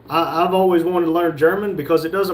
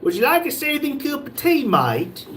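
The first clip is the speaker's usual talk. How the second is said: put-on British accent; 10 semitones higher than usual. Host reading a viewer's comment in a mock British accent. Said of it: "like a redneck talking in a British accent".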